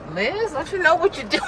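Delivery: high-pitched mock-scolding mother; imitating mother. Mother chiding her daughter's clumsy parking, high mock scolding. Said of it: "If my mother was in here, she would say".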